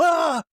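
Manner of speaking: high, startled cry of alarm; a hammed-up yelp of panic; mock panicked yelp. Adult sees his wallet float away, high panicked yelp. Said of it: "And starts".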